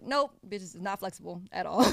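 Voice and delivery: sassy, loud comeback; loud mock retort. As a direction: snap back loudly. Retold reply, loud.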